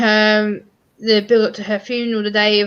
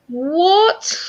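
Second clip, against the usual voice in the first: high, baffled what?; incredulous what; 8 semitones higher than usual. Retold or imitated. [name] reacting to being told to stop crying, incredulous. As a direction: act stunned by the question. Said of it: "I'm like".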